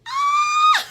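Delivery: sharp gasp into a high delighted shriek, then a laugh; gasp, then let out a huge joyful scream; overwhelmed squeal of joy. Real, not acted. Sees photo of beloved uncle.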